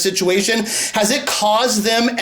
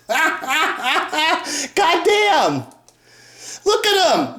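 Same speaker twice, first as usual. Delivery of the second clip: higher, laughing mockery; 8 semitones higher, slower than usual. Real, not acted. Mocking the lookalike band, laughing high. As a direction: crack up at the lookalikes. Mocks Jacksons-like branded band.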